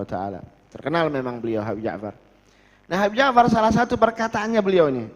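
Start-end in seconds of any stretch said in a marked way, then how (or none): none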